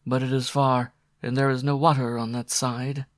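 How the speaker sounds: droning. A narrator's reading. Woman warning Waldo there is no water, droning. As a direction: keep the drone going, even and steady. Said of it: "she droned".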